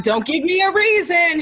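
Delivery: retold warning shout. Answering the roommate in the other room, retold shout. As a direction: call back a warning, pitched high.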